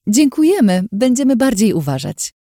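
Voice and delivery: chirpy forced politeness; bright placating smile. Acted. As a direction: beam at him and promise to behave.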